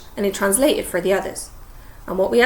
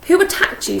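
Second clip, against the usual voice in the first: alarmed; 10 semitones higher, 5 dB louder than usual. Acted. Ron asks in alarm.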